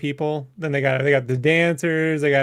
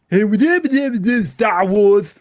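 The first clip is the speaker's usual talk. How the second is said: slurred singer impression, pitched up and louder; Newman; 4 semitones higher, 4 dB louder than usual. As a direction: mush the words in a put-on celebrity voice. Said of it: "was it Newman doing Star Wars".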